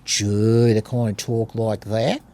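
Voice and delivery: broad mock Tasmanian with rising endings; exaggerated Tasmanian accent. Shown as a demonstration. Showing how Tasmanians talk, exaggerated Tasmanian accent. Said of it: "in Tasmania, you get people".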